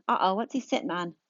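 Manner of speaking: ordinary delivery, nothing marked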